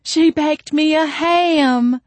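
very high, loud, drawn-out squeal; delighted squeal. A narrator's reading. Hungry mother-to-be finds food in the kitchen, squealing. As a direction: squeal it slowly with sheer joy. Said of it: "I heard her squeal".

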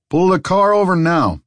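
loud, high police order over PA; loudspeaker command. Acted. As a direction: bark it through a megaphone. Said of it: "through the P A system".

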